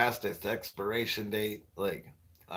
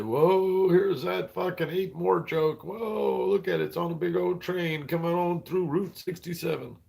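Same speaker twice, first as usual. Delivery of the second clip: hammed-up amazed chat voice, higher; mock excited viewers; 6 semitones higher than usual. Mocking viewers who saw the joke coming, hammed-up amazement. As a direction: gasp whoa like a kid spotting a train. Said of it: "You guys".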